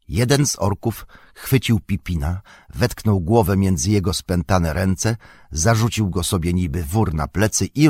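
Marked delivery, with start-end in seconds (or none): none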